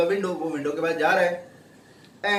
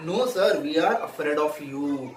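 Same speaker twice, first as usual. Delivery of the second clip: slow read-aloud; 2 semitones lower, much slower than usual. Shown as a demonstration. Teacher reads servant's line.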